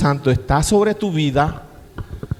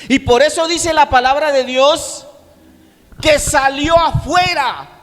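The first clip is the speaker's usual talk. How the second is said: higher, slowing shouted preaching; preaching at full force; 12 semitones higher, 5 dB louder, slower than usual. Acted. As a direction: proclaim it like a fiery preacher, drawing out the end. (predicación a todo pulmón, más aguda y lenta)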